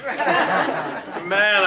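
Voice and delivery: a laughing loud man; laughing exclamation. Real, not acted. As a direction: burst out laughing on it. Laughing while recalling excitement.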